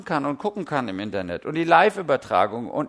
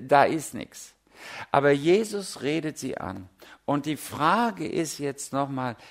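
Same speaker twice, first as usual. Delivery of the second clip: ordinary talk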